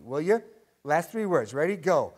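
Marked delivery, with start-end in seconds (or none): none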